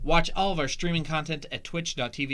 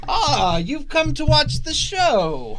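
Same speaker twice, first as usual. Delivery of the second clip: high, slow sneering drawl; Malfoy voice; 13 semitones higher, 9 dB louder, slower than usual. Acted. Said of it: "I will play Malfoy".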